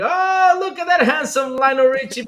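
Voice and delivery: usual speaking voice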